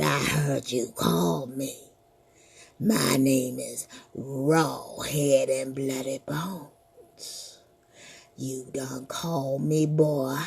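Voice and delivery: low, very slow monster voice; menacing ghoul from the grave; deep slow menacing skeleton. Acted. Skeleton speaks to the boy.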